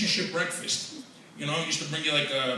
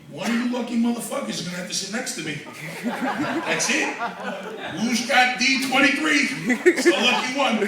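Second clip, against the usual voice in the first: louder and higher, hamming it up; mock terminal announcement; 5 semitones higher, 3 dB louder than usual. Comic joking to strangers at the gate, loud. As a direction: call out to the crowd like a barker. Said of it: "joking to people".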